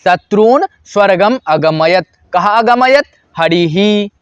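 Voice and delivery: ordinary talk, nothing marked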